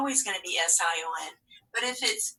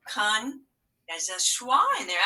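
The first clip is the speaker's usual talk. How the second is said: ordinary delivery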